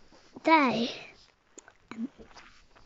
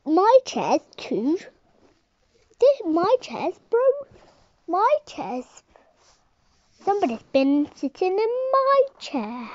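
higher, stammering bear complaint; indignant bear; 6 semitones higher, 4 dB louder than usual. Acted. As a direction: sputter it out, outraged. Daddy bear finds chair.

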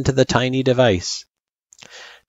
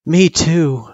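put-on young girl voice; 9 semitones higher, 4 dB louder than usual. Frisk replies.

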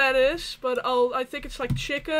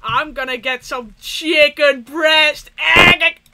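mock loud order; 3 semitones higher, 13 dB louder, slower than usual. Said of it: "and they said".

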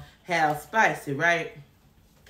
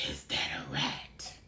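hushed whisper of alarm; 13 dB quieter than usual. Retold or imitated. Asking the worker about the rat, alarmed whisper. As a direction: breathe it secretly.

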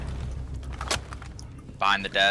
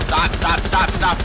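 louder, higher call to stop; urgent shout; 4 semitones higher, 5 dB louder, slower than usual. Real, not acted. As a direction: yell stop fast and urgent. Calling out an enemy planting.

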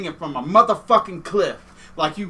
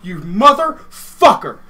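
slow furious curse; 8 dB louder, slower than usual. Pretend. Cursing the dummy standing in for Scott, slow loud fury. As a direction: drag it out, loud and seething.

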